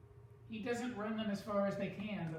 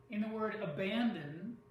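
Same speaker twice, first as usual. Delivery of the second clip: usual speaking voice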